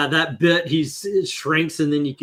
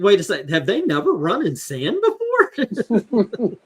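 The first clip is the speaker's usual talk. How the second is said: higher incredulous question, mock bewildered; baffled; 5 semitones higher than usual. Me hearing the beach running sound, baffled. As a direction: ask it like you can't believe it. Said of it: "I was like".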